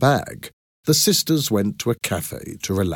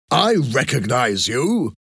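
higher, slower accusing cry; 5 semitones higher, slower than usual. A narrator's reading. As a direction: call it out in accusation. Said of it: "cried the professor".

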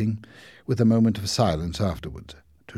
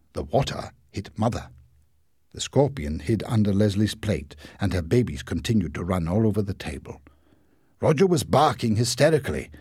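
ordinary delivery, nothing marked

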